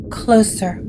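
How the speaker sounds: ordinary talk, nothing marked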